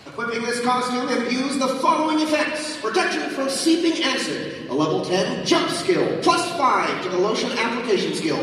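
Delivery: high, bright system announcer; game announcer voice. Acted. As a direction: announce it like a game show host.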